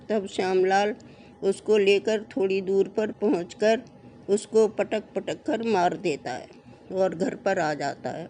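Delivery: ordinary delivery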